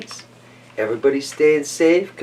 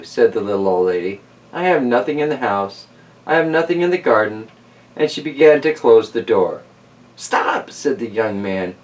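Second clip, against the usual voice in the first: lively read-aloud storyteller voice; story narration; 4 dB louder than usual. Acted. Host reading Stone Soup to kids, storytelling voice. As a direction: tell it like a bedtime picture book. Reads picture book aloud.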